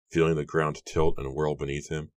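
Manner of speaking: usual speaking voice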